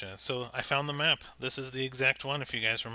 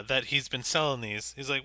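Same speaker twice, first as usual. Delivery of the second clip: usual speaking voice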